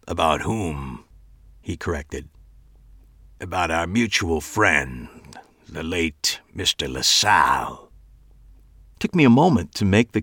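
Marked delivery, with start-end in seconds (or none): 0.1-7.9 creepy host voice. A narrator's reading.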